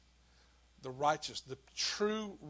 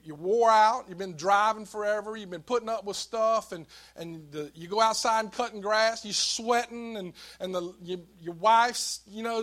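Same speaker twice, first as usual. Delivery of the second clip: louder, driving sermon delivery; forceful preaching; 5 semitones higher, 8 dB louder than usual. Acted. Pastor building up the congregation, preaching loud. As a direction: press the point to the congregation.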